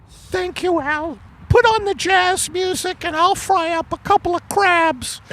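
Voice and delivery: very high falsetto wife voice; a high put-on wife, puzzled; Margaret voice. Acted couple skit, wife voice.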